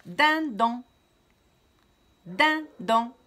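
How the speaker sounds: Dindon scandé haut et fort, din-don en deux coups; syllabes frappées. Shown as a demonstration. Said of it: "frapper deux fois dans la main". (maîtresse qui scande Dindon en deux frappes)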